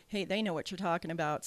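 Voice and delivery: usual speaking voice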